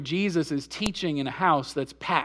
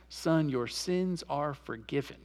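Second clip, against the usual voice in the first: soft, slow and tender; hushed tender absolution; gentle forgiving Jesus; 5 semitones lower, 9 dB quieter, slower than usual. Retold or imitated. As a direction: speak forgiveness gently to him. Jesus speaks to the paralyzed man.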